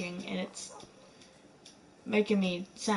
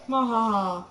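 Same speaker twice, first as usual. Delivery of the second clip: evil cackle; 4 dB louder than usual. Acted. Evil laugh.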